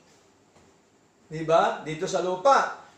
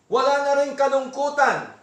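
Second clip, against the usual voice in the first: usual speaking voice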